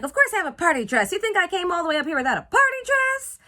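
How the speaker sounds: fast, loud indignant retort; indignant girl. Retold or imitated. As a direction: fire back, offended. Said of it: "Cindy's like".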